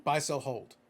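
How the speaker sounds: ordinary talk, nothing marked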